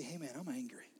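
hushed confession to Jesus; quiet honest admission; whispered prayer. Shown as a demonstration. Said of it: "you need to say".

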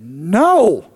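big, swooping loud no; loud blurted answer. Retold or imitated. Father answering the Holy Spirit's question, loud blurted no. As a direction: blurt it out loudly for comic effect.

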